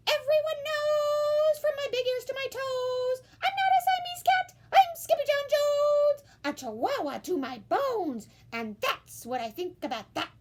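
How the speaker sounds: high, loud proud declaration; a high boastful kitten shouting who he is; proud kitty boy. A narrator's reading. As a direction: proclaim it like a tiny hero. Kitten declares himself chihuahua.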